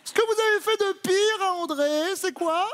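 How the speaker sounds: very high, playful questioning; high-pitched put-on voice. Host asking Claude about his worst deed, high-pitched put-on voice. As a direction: ask it way up in a squeaky register.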